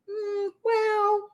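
high, drawn-out doubtful well; skeptical. Real, not acted. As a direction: raise an eyebrow with your voice. Doubting the pastors' doom talk.

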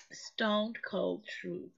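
usual speaking voice

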